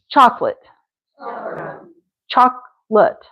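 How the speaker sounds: "chocolate" squeezed to CHOK-lut; two-syllable chocolate. Shown as a demonstration. Teacher gives shorter option, chocolate in two syllables. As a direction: skip the middle vowel in chocolate. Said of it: "Or we could just have".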